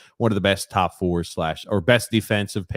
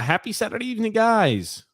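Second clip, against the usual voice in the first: high singsong greeting. Real, not acted.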